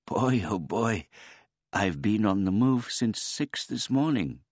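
put-on high, polite old man voice; a gentle, childlike old man; simple old man. A narrator's reading. Old man sighs contentedly.

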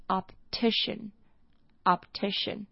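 "optician" slowly, P and T sounded, stress on "-ti-"; careful teacher repeat of "optician"; slow model pronunciation. Shown as a demonstration. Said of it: "这个重音是在T I这边".

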